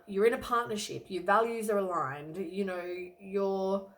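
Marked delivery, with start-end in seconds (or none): none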